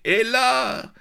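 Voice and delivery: high, loud call; crying out. A narrator's reading. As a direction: cry the name out, drawn and ringing.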